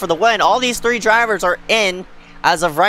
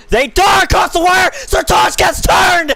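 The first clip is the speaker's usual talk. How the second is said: high, loud frantic race call; excited shouting; 9 semitones higher, 3 dB louder than usual. Real, not acted. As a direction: scream the finish in disbelief. Wild finish, commentator yelling.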